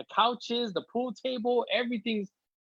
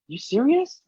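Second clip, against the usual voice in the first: higher, rising disbelief; incredulous wife; 9 semitones higher, 4 dB louder, slower than usual. Retold or imitated. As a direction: imitate her stunned question. Wife reacts to his moving news.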